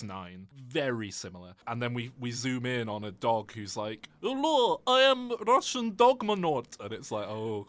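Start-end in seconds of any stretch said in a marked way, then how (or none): 4.2-6.8 put-on a high, loud, over-the-top Russian accent; cartoon Russian accent. A space dog introducing himself in a thick cartoon Russian accent. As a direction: ham up a cartoon Russian accent, slow and heavy. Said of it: "too much of a cartoon Russian, Russian accent".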